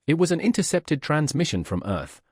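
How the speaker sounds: ordinary delivery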